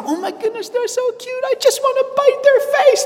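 hammed-up doting squeal; cooing at a cute kid. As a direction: squeal over a baby you want to bite. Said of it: "they go".

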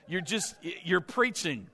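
normal talking voice

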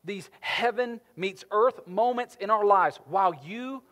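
loud, urgent sermon delivery, slowing on key words; impassioned preaching. Acted. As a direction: preach it with fire to the congregation. Preaching at full force.

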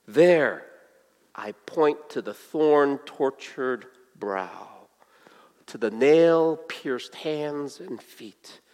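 low, soft and slow, with long pauses; reverently picture each wound, unhurried; solemn testimony. Acted. Old man's solemn answer, acted.

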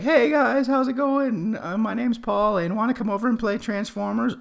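put-on very high, loud kid falsetto; mock pre-puberty voice of your younger self; squeaky grade-eight voice. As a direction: pitch it way up like a young schoolboy. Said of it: "in grade eight, uh, my voice was like".

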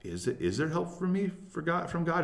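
high-pitched anxious plea, acted out; tense, intense questioning. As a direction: ask it as an intense, pleading question. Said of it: "a tense and intense emotion that says".

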